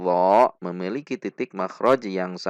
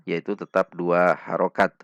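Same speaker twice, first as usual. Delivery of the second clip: ordinary talk, nothing marked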